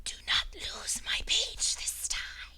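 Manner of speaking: high, slow whispered orders; eerie whisper. Acted. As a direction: command in a strange whisper. El Conquistador giving orders.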